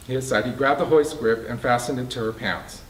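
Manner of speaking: usual speaking voice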